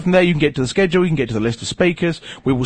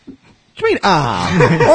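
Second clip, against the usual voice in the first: mock indignation; slower than usual. Real, not acted.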